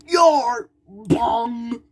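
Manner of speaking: loud high yar then bong; high mock yell and clang; battle yell. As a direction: shout the hit. Attack yell and impact sound.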